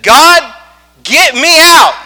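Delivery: high, loud plea to God; acted-out cry for deliverance; desperate child's prayer. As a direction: shout it upward, each word punched out. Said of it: "And this was my prayer".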